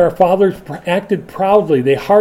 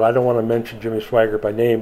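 usual speaking voice

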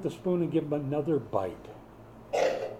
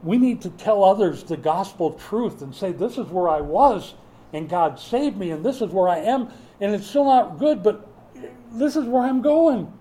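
rising, louder and higher preaching; building to a shout; 6 semitones higher, 7 dB louder than usual. Real, not acted. Pastor urging the church to witness, building to a louder, higher call. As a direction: build it to a ringing cry.

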